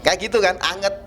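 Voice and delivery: usual speaking voice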